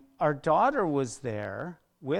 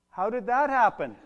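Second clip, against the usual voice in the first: higher and louder, disbelieving; stunned question; 9 semitones higher, 7 dB louder than usual. Retold or imitated. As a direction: blurt it out in shock. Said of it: "the stupidest question of all".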